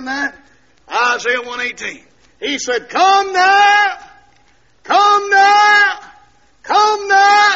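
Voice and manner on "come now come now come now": high, loud repeated summons; hollered pulpit call. Retold or imitated. Preacher preaching Isaiah to the congregation, hollering. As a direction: holler each call like a preacher, pausing between.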